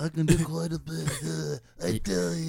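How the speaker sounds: a bad British accent that turns mobster; British accent, slipping Italian. Shown as a demonstration. Comic trying British and landing on Godfather. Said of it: "Everything he does is Godfather".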